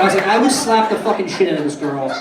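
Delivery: usual speaking voice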